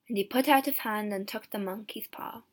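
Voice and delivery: ordinary delivery, nothing marked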